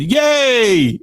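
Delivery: put-on high-pitched, drawn-out little-kid cheer; imitated toddler cheer. Host voicing a listener's two-year-old son, imitated toddler cheer. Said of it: "and he said".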